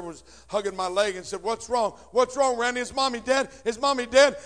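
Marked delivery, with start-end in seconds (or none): 1.4-4.4 a hint of a scared child, higher; frightened little brother. Little brother hugging Randy's leg, lightly voiced fear. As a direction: lightly voice the panicked little boy. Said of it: "My little brother had run downstairs".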